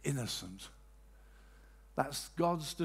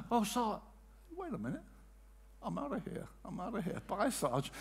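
casual dismissal; 5 semitones higher, 5 dB quieter than usual. Retold or imitated. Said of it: "You say".

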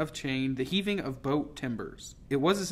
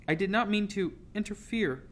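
higher, meek attempt to explain; 7 semitones higher than usual. A narrator's reading. Girl explaining herself to the silent captain, meek. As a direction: voice a timid apology, pitched up. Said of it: "I managed to say, now meekly advancing".

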